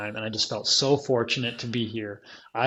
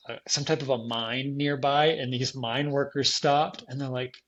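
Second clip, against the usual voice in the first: ordinary talk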